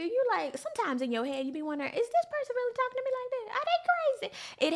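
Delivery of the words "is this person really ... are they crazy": high, fast disbelieving inner voice; incredulous inner voice. Retold or imitated. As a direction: act out outraged disbelief. Inner disbelief at being talked down.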